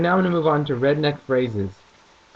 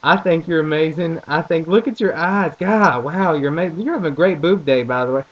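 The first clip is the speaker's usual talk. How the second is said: gushing Southern charm; 2 semitones higher, 4 dB louder than usual. Retold or imitated. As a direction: lay the Southern flattery on thick. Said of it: "I'll seriously be like".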